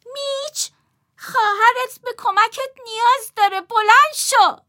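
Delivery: high, loud near-shout, slow on the last words. A narrator's reading. Friend shouting at the lazy brother, high and loud. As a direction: yell at him to get up. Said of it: "تقریباً با فریاد گفت".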